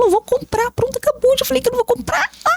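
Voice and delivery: bem mais agudo, estourando; impaciência por dentro. Retold or imitated. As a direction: desabafa a irritação escondida. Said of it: "Por dentro, eu já falava". (mãe irritada por dentro, voz aguda)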